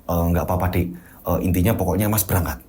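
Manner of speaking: low, deep husband voice; husband hiding his fear, low and evasive; husband's voice. Acted. (suara suami yang rendah dan gelisah)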